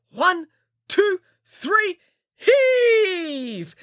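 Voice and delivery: high count building to a long call; rallying count. Acted. Nanny leads children pulling Boris free, rallying count. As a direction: count them in, high and drawn out.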